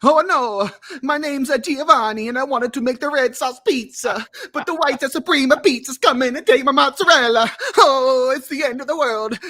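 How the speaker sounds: high, loud, hammed-up Italian accent; a comic Italian pizza chef who is wailing in an Italian accent; Giovanni Italian accent. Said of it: "my Italian accent".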